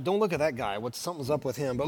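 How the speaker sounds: low, soft mimicry of uneasy partygoers; furtive mock aside. Imagined partygoers discussing the odd monk, in a furtive undertone. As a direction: drop it down like a discreet warning to a friend.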